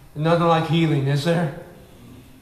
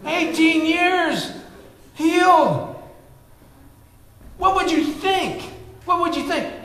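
excited preaching; 11 semitones higher, 3 dB louder than usual. Acted. Pastor marveling to his church, high and excited. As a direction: marvel aloud at the miracle for the crowd.

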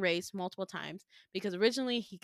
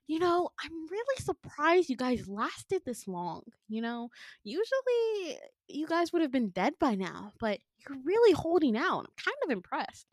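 mock impressed; 7 semitones higher than usual. Villain sizing up the friends who lasted, mock impressed. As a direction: act pleasantly amazed in a higher voice.

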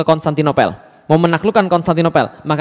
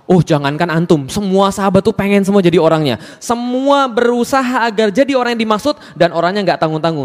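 normal talking voice

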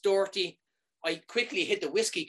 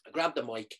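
usual speaking voice

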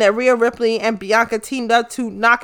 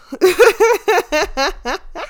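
laugh; 9 semitones higher than usual. Real, not acted. Laugh after her rant.

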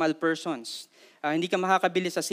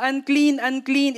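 high, louder warning cry; leper's shout; 7 semitones higher, 4 dB louder, slower than usual. Retold or imitated. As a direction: call out "unclean" so people keep away. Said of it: "para siyang ganun".

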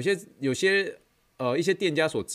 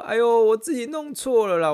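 high, loud "哎呦" from the embarrassed aunt; imitating the aunt; 13 semitones higher, 8 dB louder than usual. Trainer voicing the aunt admitting her mistake, high and loud. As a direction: squeal it out like a flustered client. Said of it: "美国白人这样".

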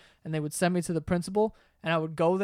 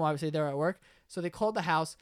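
usual speaking voice

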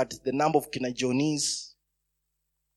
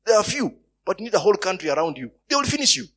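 louder and higher, pressing the danger; rising, forceful counsel; urgent warning; 6 semitones higher, 5 dB louder, faster than usual. Acted. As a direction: warn the king he will be crushed. Acting Hushai's urgent warning.

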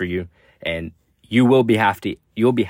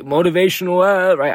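mock motivational; 7 semitones higher, 5 dB louder than usual. Shown as a demonstration. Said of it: "this shit sounds, like, cheesy".

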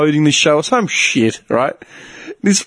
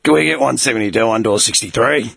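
deep authoritative voice; 5 semitones lower than usual. Retold or imitated. Worker on the squawk radio calling the yardies, mock authoritative. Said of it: "What's this deep authoritative voice?".

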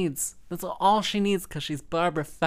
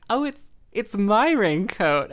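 surprised realization; 6 semitones higher, 7 dB louder, slower than usual. Retold or imitated. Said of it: "I was like".

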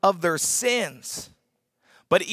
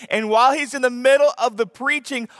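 ordinary talk, nothing marked

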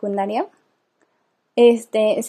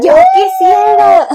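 slow, much higher, loud mock cry; mock shout; 9 dB louder, much slower than usual. Friend chiming in with a slow, high mock shout. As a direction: belt it out, stretched and high.

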